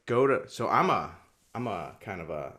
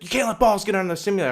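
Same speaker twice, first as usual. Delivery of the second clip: fast, high, loud mock scolding; imitated yelling; 9 semitones higher, 6 dB louder, much faster than usual. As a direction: rattle off her yelled complaint. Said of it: "her yelling at me nonstop".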